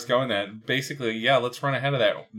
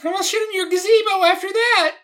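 high squeaky Toad voice; 7 dB louder, faster than usual. Acted.

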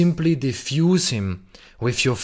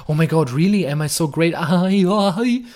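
higher, giddy squeals of delight; excited little boy; 4 semitones higher than usual. Retold or imitated. Man showered with compliments, giddy like a little boy. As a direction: gush like a little boy who was praised. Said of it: "getting excited like a little boy".

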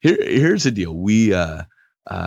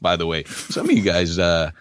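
ordinary talk

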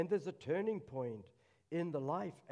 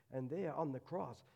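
normal talking voice

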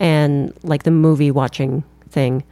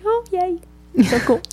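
squeaky sarcastic yay; high, thin fake enthusiasm; mock cheer; 12 semitones higher than usual.

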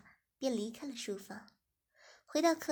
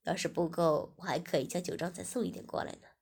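lower, generous man, quickening; male host voice; 5 semitones lower, 3 dB louder than usual. Acted. Host promising Ziying more wine, lower and quick. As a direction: drop low and speed up the offer.

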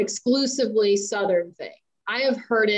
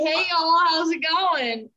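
higher, slow and easy "hey, y'all" greeting; friendly greeting to his posse; 8 semitones higher than usual. Retold or imitated. Showing his cohost how he greets his posse, a higher, slow friendly call. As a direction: hail your buddies, lightly drawn out. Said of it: "I can walk up to my posse and be like".

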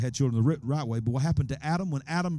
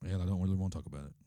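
evasive snake; 5 semitones lower, 8 dB quieter, much faster than usual. Acted. Snake voiced answering God.

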